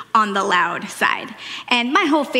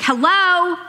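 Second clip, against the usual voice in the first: higher and louder, demanding an answer; calling out; 7 semitones higher, 4 dB louder than usual. Retold or imitated. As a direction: call out to a family ignoring her. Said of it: "So I'm like".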